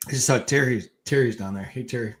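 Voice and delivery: ordinary talk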